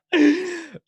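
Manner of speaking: high, loud real laugh; a helpless burst of laughter. Real, not acted. Player cracks up at table.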